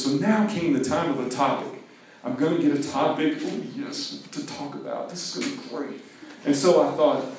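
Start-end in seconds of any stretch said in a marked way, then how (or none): 3.4-6.0 whisper. Acted. Gleeful aside to himself.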